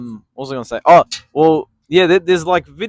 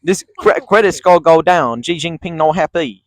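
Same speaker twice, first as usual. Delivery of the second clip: mock Chinese accent; 3 semitones higher, 6 dB louder than usual. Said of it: "Chinese right now".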